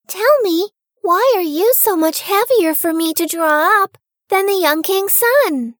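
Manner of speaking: Rapunzel voice. A narrator's reading. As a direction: ask it naively, as the young girl. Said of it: "Rapunzel said to her".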